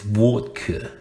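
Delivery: rough attempt at a Russian accent; one word in a mock Russian accent. Shown as a demonstration. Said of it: "with the Russian accent".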